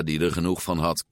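plain reading voice

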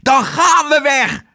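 high, loud furious cry; furious shout. A narrator's reading. The furious cook shouts at Otje that they will leave. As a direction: roar it out in fury. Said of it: "riep Tos woedend".

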